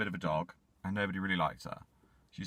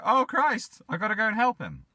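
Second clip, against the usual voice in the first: high, loud alarm; 12 semitones higher, 6 dB louder than usual. Retold or imitated. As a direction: rush to help in alarm. Echo panicking, high.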